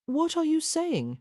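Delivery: high, lightly pleading question; a touch of a plea. A narrator's reading. Woman to her lover in confusion, lightly pleading. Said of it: "she pleaded".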